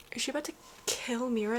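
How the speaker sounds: normal talking voice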